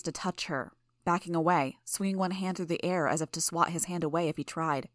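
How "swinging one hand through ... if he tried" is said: ordinary delivery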